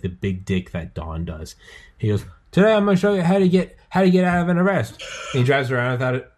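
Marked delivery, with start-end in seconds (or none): 2.5-5.0 loud, high-pitched Australian street ranter; Aussie Cossack. Retold or imitated. Host mimicking an Aussie YouTuber's video intro, brash and loud. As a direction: project it brashly, high and quick. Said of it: "he's the Aussie Cossack".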